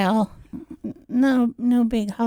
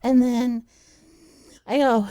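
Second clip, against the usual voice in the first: ordinary talk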